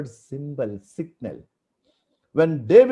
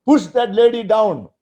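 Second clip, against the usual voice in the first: commanding shout; 7 semitones higher, 8 dB louder than usual. Retold or imitated. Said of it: "Jehu said".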